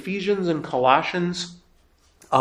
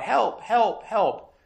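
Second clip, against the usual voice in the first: mock cry for help; 7 semitones higher, much slower than usual. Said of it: "And you cry out".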